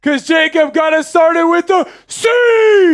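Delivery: loud high hype shout; hyped shout. Real, not acted. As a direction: fire up the chant. Hype chant start.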